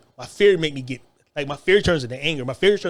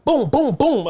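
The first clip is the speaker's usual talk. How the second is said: much higher, louder boom boom boom; punch noises; 8 semitones higher, 6 dB louder, slower than usual. Retold or imitated. Acting out the fight, loud booming punch noises.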